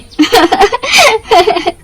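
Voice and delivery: high, mean cackle; mocking laugh. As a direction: laugh at her cousin. Mocking laugh after taunt. (ऊँची, चिढ़ाती हँसी)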